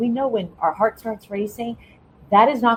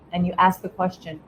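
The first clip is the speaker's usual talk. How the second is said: ordinary talk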